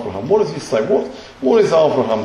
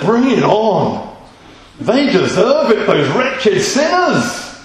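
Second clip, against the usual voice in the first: higher, slow, gloating mock Abraham calling down fire; a hammed-up self-righteous Abraham, higher and slow; gloating eager Abraham; 6 semitones higher, 3 dB louder, slower than usual. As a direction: relish the punishment like a zealot. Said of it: "the modern, updated, believing version".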